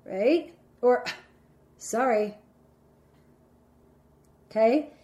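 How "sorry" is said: insincere sorry. Shown as a demonstration. Someone who doesn't feel sorry, giving an insincere apology. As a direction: say sorry without feeling it. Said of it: "the person doesn't feel sorry".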